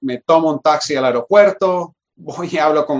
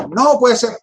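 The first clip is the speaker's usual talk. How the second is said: high, emphatic incredulous quote; exasperated, higher-pitched protest; retold disbelief; 8 semitones higher, 4 dB louder than usual. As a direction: act out not believing it. Acts out disbelief at the refusal.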